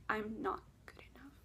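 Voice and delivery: a faint breathy whisper; despairing whisper. Retold or imitated. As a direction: let it fall to a whisper of defeat. Crying in dream, giving up.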